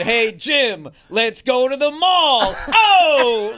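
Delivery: loud, slow comic-character yell; mock heckling shout, drawn out and rising; Dice. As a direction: imitate a brash comic calling across the room. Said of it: "Patrice starts yelling to Jim in this Dice character".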